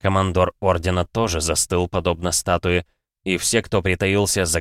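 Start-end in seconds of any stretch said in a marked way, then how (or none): none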